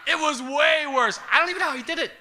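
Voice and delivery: high, loud, stretched-out punchline; incredulous comic exclamation; gleeful disbelief. Real, not acted. Triumphant punchline about the recut movie.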